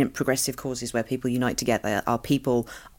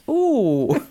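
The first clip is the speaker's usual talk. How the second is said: dim sitcom mum. Pretend. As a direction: coo like a sweet, slightly dim mum. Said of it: "very Ma Larkin".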